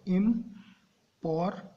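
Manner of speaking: Important slowly in three detached beats, "im - por - tent"; split into syllables. Shown as a demonstration. Teacher repeats to prove three syllables, important broken up. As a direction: separate each syllable of important with a pause. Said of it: "तीन syllable है".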